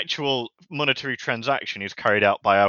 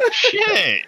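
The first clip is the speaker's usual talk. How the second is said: very high disbelieving shriek; shocked high squeal; 3 dB louder than usual. Real, not acted. Reacting to the player count, high shocked shriek.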